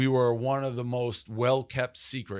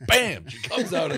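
louder, higher "bam"; punchy exclamation; 8 semitones higher, 5 dB louder than usual. Shown as a demonstration. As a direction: punch it like a sudden impact. Exclaims an imagined smoke-show entrance.

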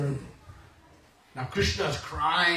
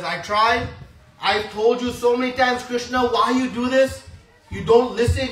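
higher, louder lecturing of a naughty sibling; scolding elder brother; 4 semitones higher, 5 dB louder than usual. Acted. Elder brother Balram scolds crying Krishna, loud and high. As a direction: tell him off for his mischief. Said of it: "'Cause he's the elder brother".

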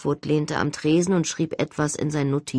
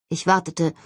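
plain reading voice

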